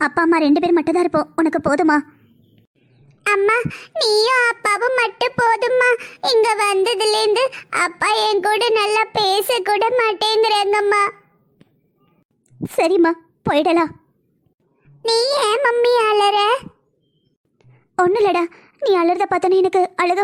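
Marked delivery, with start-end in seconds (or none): 3.3-11.0 put-on high, slow little kid's voice, sad; child voice. Little son tells mother dad ignores him, slow high child voice. 15.0-16.4 put-on high, slow worried little kid; child voice. Little son asks why mommy is crying, high slow child voice.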